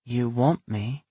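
lightly voiced seductive woman; seductive female voice. A narrator's reading. Seductive whisper to him.

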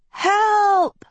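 high, drawn-out scream; screamed cry for help. A narrator's reading. Agatha hanging from the gutter rail screams for rescue, screamed. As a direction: scream it out long, pitch way up. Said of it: "she screamed".

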